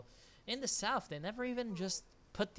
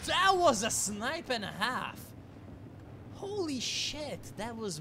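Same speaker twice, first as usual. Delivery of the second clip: higher and louder, slow, stunned awe; amazed exclamation; 8 semitones higher, 5 dB louder, slower than usual. Real, not acted. Caster amazed by a snipe from over a screen away, slow and loud. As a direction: marvel out loud at the long shot, drawn out.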